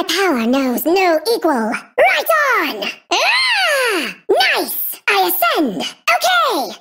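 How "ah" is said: high, sustained "aah"; drawn-out cry. Acted. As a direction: let out a long, high cry. Game character cries out.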